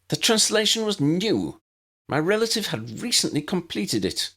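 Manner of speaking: high, pressing insistence; insist, a touch unsure; insistent, losing confidence. A narrator's reading. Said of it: "Aloria insisted".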